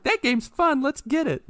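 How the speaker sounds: high-pitched impression of an excited kid; excited son. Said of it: "my son will be like".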